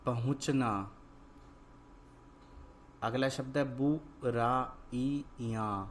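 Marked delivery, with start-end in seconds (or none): 3.8-5.8 बुराइयां split into slow separate syllables; बुराइयां drawn out, every syllable held apart. Shown as a demonstration. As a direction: sound out बुराइयां piece by piece. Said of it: "तो क्या शब्द बना".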